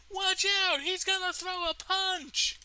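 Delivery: very high, strained yell; mock warning shout. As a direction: imitate a bystander crying out in alarm. Retold bystander shouting a warning.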